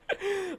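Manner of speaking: soft high giggle; helpless little high laugh. Real, not acted. Amused by pizza on dad's head.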